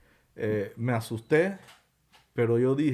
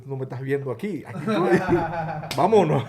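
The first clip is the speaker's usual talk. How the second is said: laughing; 5 semitones higher, 6 dB louder than usual. Retold or imitated. Laughing reply to the bus driver.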